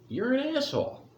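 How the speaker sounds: high squeaky insult voice; mock outburst. Calling pastor an asshole.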